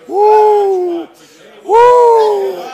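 high, loud joyful whoop; exultant whoop. Real, not acted. Preacher whooping at the congregation in joy. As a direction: let out a holler of joy.

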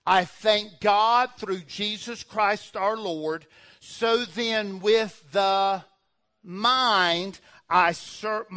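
loud, slow preacher's reading; emphatic scripture reading. Real, not acted. Preacher reading Romans to the congregation, loud and slow. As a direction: proclaim the verse, stretching key words. Reads scripture aloud with weight.

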